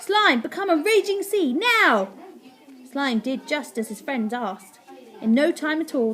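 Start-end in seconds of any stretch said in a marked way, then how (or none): 0.0-2.1 high, loud order; urgent command. A narrator's reading. Boy commands Slime as cats near, urgent shout. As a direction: build to a shouted final word.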